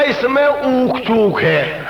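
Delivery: very slow, stretched-out verdict; drawn-out punchline. Acted. Old man casts doubt on the third son, drawn-out punchline. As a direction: drag out each word for the laugh.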